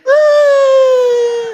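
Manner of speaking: high, loud lovesick sighs; hammed-up fangirl swoon; mock swooning fan. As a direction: gush over the photo like a smitten teen. Mocking swooning idol fans.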